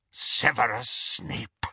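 slow eerie hiss from the dark; ghostly whisper. A narrator's reading. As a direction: draw the name out slowly, eerily. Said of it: "Mad-Eye Moody's voice whispered out of the darkness".

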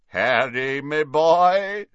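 hearty booming call rising in pitch; big put-on voice, swelling upward. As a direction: boom it out as a jovial greeting. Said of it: "said a booming voice".

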